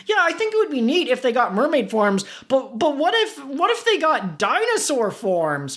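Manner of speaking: high, nerdy eager voice; Pete character voice. Acted. Pete pitches idea.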